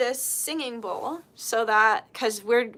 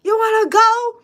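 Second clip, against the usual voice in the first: high, loud comic challenge; loud, high-pitched mock threat; mock fight challenge; 11 semitones higher, 9 dB louder than usual. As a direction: imitate grandpa picking a fight. Grandpa mock-challenging a bar stool.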